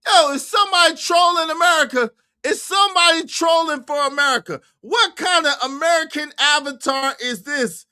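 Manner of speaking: high-pitched indignant questioning; incredulous. Real, not acted. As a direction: ask it as if being trolled. Accuses clip of trolling.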